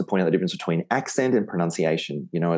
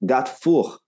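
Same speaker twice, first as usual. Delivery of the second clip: put-on higher French-accented "gat foor", words apart; thick French accent; 5 semitones higher, much slower than usual. French friend sure he is clear, higher French accent. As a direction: insist on gate four in a French accent. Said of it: "with his thick, you know, French".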